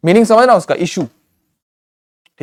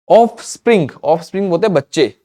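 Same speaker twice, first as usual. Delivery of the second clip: ordinary delivery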